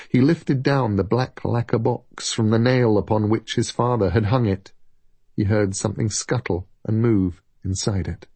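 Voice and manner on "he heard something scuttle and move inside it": plain reading voice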